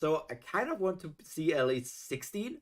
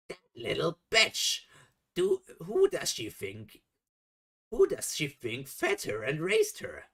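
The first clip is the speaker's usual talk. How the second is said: mock-furious outburst, higher pitched; angry rant; 4 semitones higher than usual. Reader voices raging Mama in the story, angry rant. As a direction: act out an indignant tirade. Acts out Mama's enraged rant.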